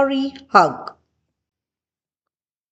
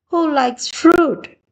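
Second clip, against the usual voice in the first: high bright; 7 semitones higher than usual. A narrator's reading. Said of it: "Sally said".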